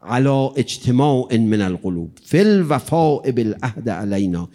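normal talking voice

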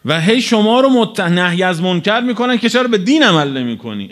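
ordinary delivery

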